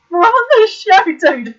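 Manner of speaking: loud, high, exasperated; exasperated shout. Retold or imitated. Telling the chat she's on the show live, exasperated yell. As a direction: yell it like you can't believe it. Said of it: "And I was like".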